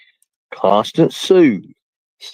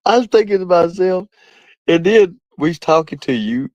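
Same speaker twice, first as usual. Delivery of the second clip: laughing; 8 semitones higher than usual. Real, not acted. Laughing while retelling the hospital call.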